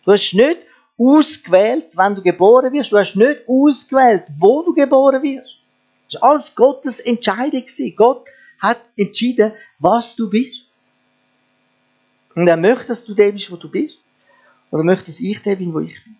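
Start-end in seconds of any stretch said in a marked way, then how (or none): none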